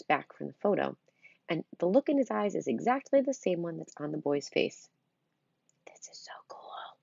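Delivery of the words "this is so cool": soft awed whisper. Acted. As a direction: breathe it in awe. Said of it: "I whisper".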